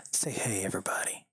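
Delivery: gasping, whispered cue to the dog. Real, not acted.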